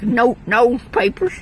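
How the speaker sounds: high, loud southern drawl, breaking into laughter; exaggerated southern accent. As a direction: overdo the southern vowels in exasperation. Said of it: "is it my southern accent?".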